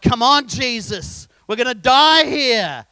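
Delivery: loud, slow, desperate plea; frightened disciples shouting at a sleeping Jesus; panicked shout. Retold or imitated. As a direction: yell it at Jesus like the boat is sinking. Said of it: "It's like".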